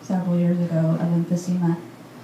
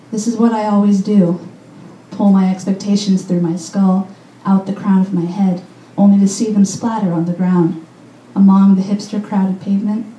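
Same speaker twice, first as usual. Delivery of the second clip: louder, emphatic poem recital; poetry reading; 6 dB louder than usual. Acted. Poet reading The Myth of Baltimore to listeners, emphatic recital. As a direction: project each line to the room in measured phrases.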